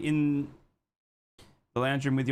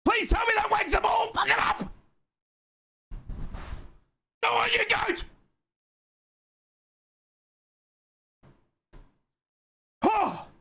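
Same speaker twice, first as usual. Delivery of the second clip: real full-voiced screaming, pitch far above usual; angry celebration. Real, not acted. Host celebrates Riley's goal after a poor half, angry screaming. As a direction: scream the goal home in furious joy. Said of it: "most angriest I've ever been celebrating".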